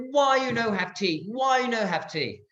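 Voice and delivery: high-pitched mock exasperation in broken English; incredulous, outraged high squawk; apoplectic. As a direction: imitate an Iraqi guard losing it. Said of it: "The Iraqis went apoplectic".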